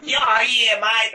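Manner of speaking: high, loud mock Australian rapper; mock Australian accent. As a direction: ham up an Aussie MC going grimy. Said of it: "the Australian accent".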